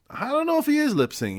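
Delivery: very high-pitched, doubtful quote; puzzled audience reaction. Retold or imitated. Voicing a puzzled concertgoer's reaction.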